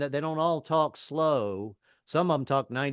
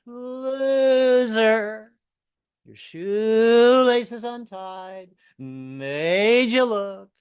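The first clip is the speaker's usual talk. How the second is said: mocking heckle; 10 semitones higher, 10 dB louder, much slower than usual. Woman heckling from car.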